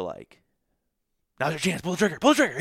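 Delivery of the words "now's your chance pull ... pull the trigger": much higher and louder, urging himself on; hiss-free frantic self-push, rising each time; a hunter's racing head yelling to shoot; urgent inner voice. Retold or imitated. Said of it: "feel this intense pressure to".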